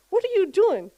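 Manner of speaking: high, loud surprised question; surprised mom. Retold or imitated. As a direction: catch a kid in the act, startled. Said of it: "I'm like".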